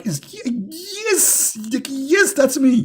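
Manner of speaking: high, squealing self-satisfied yes; mock smug delight. Mocking the prophet's pleasure when praised, high and gleeful. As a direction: play a preening know-it-all. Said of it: "he feel like he's like".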